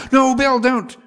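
high, loud frantic plea; screaming director. Retold or imitated. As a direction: shout him down as the alarmed director. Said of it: "who's a screaming, raging man".

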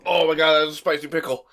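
startled reaction to heat; surprised. Real, not acted. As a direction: blurt it out, caught off guard. Biting hot pickle.

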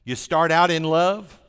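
loud emphatic preaching. Acted. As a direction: proclaim it to the congregation. Preaching at full force.

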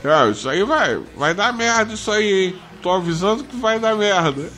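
put-on high, slurry drunk uncle voice. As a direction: warn like a tipsy neighbour. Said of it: "com aquela voz de, de bêbado mesmo".

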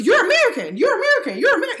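high, loud excited chatter; loud, high-pitched mock excitement; excited locals. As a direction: mimic thrilled strangers spotting an American. Foreigners excitedly recognizing American accent.

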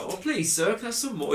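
put-on high, over-the-top Oliver Twist Cockney accent. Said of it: "terrible sort of Cockney accent".

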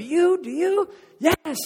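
high, loud, eager call and answer; excited crowd exchange. Acted. Showman picking out believers, high excited shouts.

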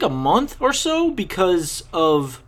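ordinary talk, nothing marked

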